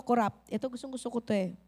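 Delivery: ordinary talk, nothing marked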